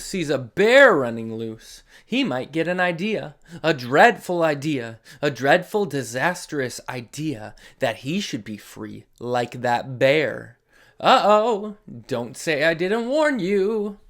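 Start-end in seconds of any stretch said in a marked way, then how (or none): none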